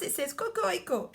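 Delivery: "cocorico" said quick and high, French style; French rooster call. Shown as a demonstration. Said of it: "In France".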